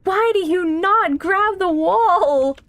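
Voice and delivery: slow, drawn-out high wail of frustration; exasperated yell. Real, not acted. Player scolding the climber who keeps falling, exasperated. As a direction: stretch every word in loud exasperation.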